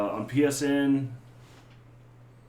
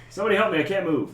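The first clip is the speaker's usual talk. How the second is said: higher, louder little pleading cries; fluttering cries for help; 6 semitones higher, 4 dB louder, faster than usual. Pretend. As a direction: play a tiny figure calling for help. Voicing a toy figure.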